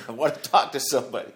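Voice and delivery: put-on jailed drunk yelling for someone to come talk. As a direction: shout it down at the guards. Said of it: "yelling down at the cops".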